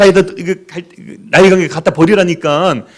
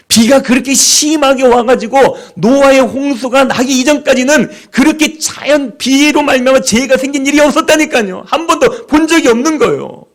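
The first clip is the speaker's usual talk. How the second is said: higher, louder sermon build; impassioned preaching; 7 semitones higher, 3 dB louder than usual. Acted. Preacher urging the congregation, higher and louder. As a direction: drive it home from the pulpit.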